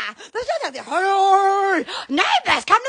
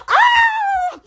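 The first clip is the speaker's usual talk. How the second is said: very high sustained "oh"; piercingly high excited cry; high squeal; 14 semitones higher, 4 dB louder than usual. Real, not acted. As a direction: squeal it out long. Squealing after declaration.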